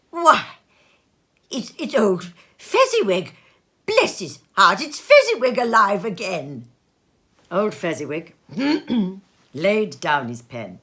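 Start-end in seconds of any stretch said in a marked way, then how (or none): 0.1-6.7 loud, high excited outburst; great excitement. A narrator's reading. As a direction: burst out with delighted recognition. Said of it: "Scrooge cried in great excitement".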